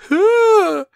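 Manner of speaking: high loud wail of a sobbing fit; hammed-up crying shriek; mock crying wail. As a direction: squeal like a blubbering wreck. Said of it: "I'm not gonna be like".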